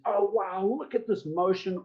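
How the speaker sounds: high, mock-amazed gasp of wonder; mock amazement. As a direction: act out gawping at something new.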